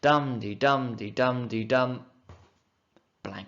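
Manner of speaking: slow dum-de chant ending on a dropped beat; teacher showing the missing syllable; rhythm demo with missing beat. Shown as a demonstration. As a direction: beat it out, then leave the last beat empty. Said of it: "misses off a syllable at the end".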